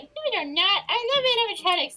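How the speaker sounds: high, loud and fast gushing; fanboy squeal; gushing excitement. Acted. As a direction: rush out the love for animatronics. Dad defends animatronics.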